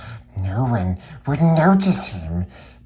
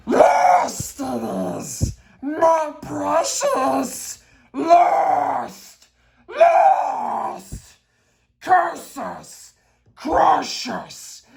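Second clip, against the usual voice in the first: much higher, slow, wailing sobs; keen over your lost treasure; Gollum crying out; 3 dB louder, much slower than usual. Acted. Gollum mourns his lost precious, wailing high and slow.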